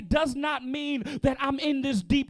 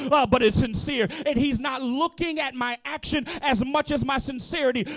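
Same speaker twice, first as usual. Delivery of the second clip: ordinary delivery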